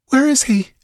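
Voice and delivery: high frightened question; fearful cry. Acted. Frightened girl asking after the monster, high and fearful. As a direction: she shudders, asking where he went. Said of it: "she shuddered".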